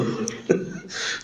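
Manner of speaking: soft, breathy real chuckle; short chuckle. Real, not acted. Preacher amused by his own story, chuckling softly. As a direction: let out a quiet amused laugh. Amused after quoting the elder's offer.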